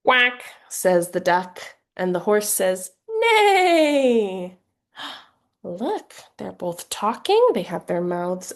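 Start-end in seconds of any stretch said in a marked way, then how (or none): 0.0-4.6 neigh like a horse for a little one; picture-book reading, horse sound on top; reading to children. Shown as a demonstration. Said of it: "an example of how you might read this book". 5.0-6.1 mock surprise. Shown as a demonstration. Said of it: "an example of how you might read this book".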